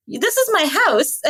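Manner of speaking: lightly voiced, patient correction; a hint of a bemused host; matter-of-fact homeowner. Homeowner tells guests they're wrong.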